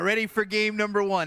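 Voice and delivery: rally the troops for game one; hyped announcer. Acted. Hyped after hooah.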